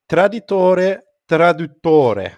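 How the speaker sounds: "traditore, traduttore" said as an Italian saying, carefully, Italian vowels; Italian pronunciation. Shown as a demonstration. Quoting an Italian saying from his undergraduate years to his co-host, carefully pronounced Italian. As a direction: say "traditore, traduttore" the Italian way, trying hard to get it right. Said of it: "uh, mispronounce here".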